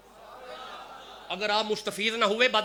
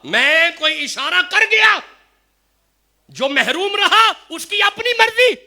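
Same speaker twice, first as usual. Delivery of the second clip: raised, ringing preacher's voice over the chain; preaching at full force; 8 semitones higher, 7 dB louder than usual. Acted. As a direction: proclaim each link louder and higher. Chain of saints preached loudly. (جوش سے بلند آواز میں وعظ)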